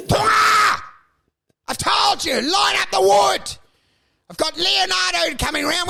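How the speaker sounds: high-pitched hammed-up yelling at the help; angry berating boss. Actor yelling at the cleaner about the wood, hammed-up anger. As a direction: rant at full tilt, voice pushed right up.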